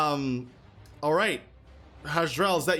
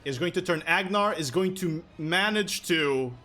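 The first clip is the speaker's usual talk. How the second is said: ordinary talk, nothing marked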